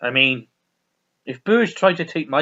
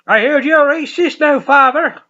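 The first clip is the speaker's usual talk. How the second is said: high loud mock Irish accusation; hammed-up high accusing voice; character voice; 11 semitones higher, 9 dB louder than usual. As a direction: play the priest, loud and high. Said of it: "He's like".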